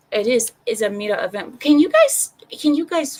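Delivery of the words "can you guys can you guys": high, loud interruption; exasperated call for attention. Real, not acted. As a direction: raise your voice to pull them back. Calls distracted chat back to topic.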